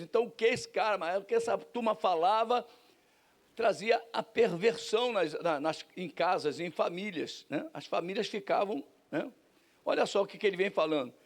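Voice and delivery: ordinary talk, nothing marked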